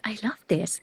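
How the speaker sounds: breathy whispered joy; whispered delight. Retold or imitated. After the bride's praise, a whispered delight. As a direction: whisper it like a secret thrill.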